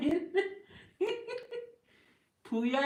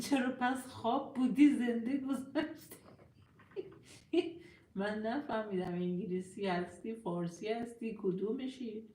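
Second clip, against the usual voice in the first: ordinary talk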